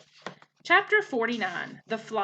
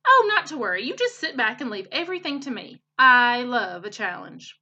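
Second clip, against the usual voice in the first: eager beaver; 2 semitones higher, 6 dB louder than usual. Acted. Beaver reassuring Bright Bill he loves a challenge, loud and eager. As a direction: bounce in and reassure, relishing the challenge.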